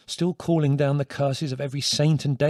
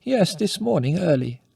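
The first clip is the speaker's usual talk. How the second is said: absently; 7 semitones higher, 5 dB louder than usual. A narrator's reading. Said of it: "she said absently".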